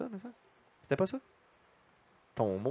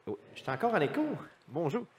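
usual speaking voice

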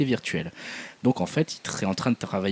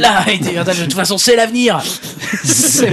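high, loud put-on voice; impression voice; 10 semitones higher, 8 dB louder than usual. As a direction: mimic a famous speaker, pitched way up. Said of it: "Si les gens reconnaissent cette imitation".

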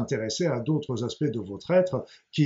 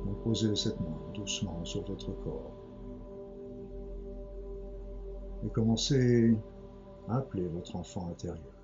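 guided meditation; 5 semitones lower, 9 dB quieter than usual. Acted. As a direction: ease them gently into calm.

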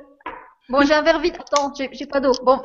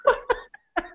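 en riant; 7 semitones higher than usual. Real, not acted. Laughs after the toast joke.

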